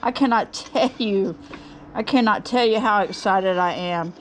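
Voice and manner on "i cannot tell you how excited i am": normal talking voice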